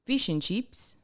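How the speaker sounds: Australian "Fish and chips" with ee vowels; Australian accent. Shown as a demonstration. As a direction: say "Fish and chips" as "feesh and cheeps". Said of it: "I believe Australian is".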